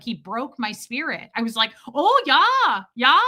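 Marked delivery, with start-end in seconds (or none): 1.9-3.2 put-on high, loud caricature Midwestern accent; adopted Midwestern accent. Traveler's spirit broken by stranger, adopted Midwestern accent. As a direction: give in to the Midwestern lilt. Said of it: "I started adopting it".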